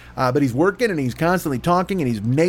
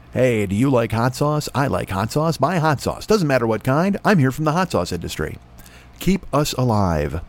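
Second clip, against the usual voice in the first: hot sauce ad voice. Pretend. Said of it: "what a voiceover guy".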